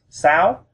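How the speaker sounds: "sao" swept sharply up in pitch; rising tone. Shown as a demonstration. Teacher showing number six, "sao" with rising tone. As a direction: raise the voice through "sao". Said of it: "we raise our voice".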